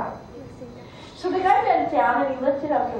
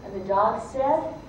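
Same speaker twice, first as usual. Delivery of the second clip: usual speaking voice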